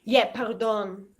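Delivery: ordinary delivery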